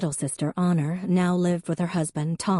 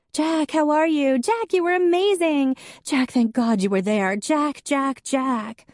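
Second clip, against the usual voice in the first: high, loud, excited chatter of many women; a crowd of ladies gushing over him; 10 semitones higher, 5 dB louder than usual. A narrator's reading. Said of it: "A dozen or so women talked at once".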